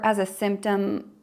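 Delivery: usual speaking voice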